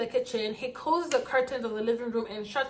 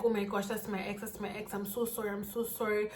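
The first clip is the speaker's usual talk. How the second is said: hushed retold apology; 8 dB quieter than usual. Man explaining, apologising, acted quietly.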